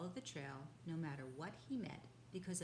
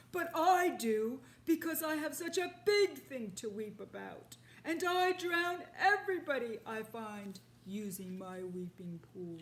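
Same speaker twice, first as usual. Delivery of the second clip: much louder, higher angry rhino; 4 semitones higher, 11 dB louder than usual. Acted. Rhinoceros scolding the father, angry. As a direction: snap back at the intruder. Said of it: "said the angry voice".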